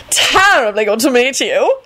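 slow, high, effusive greeting; dramatic. A narrator's reading. Effeminate vampire greeting the ambassador, dramatically. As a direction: gush it theatrically. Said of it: "he replied dramatically".

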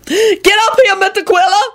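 high, panicked wail. A narrator's reading. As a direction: wail it from up a tree. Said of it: "Lucretia wailed".

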